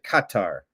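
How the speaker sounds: Qatar said as "KAH-tar"; copied pronunciation. Shown as a demonstration. Host copying the Emir's pronunciation, Qatar as "KAH-tar". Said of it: "He pronounced it".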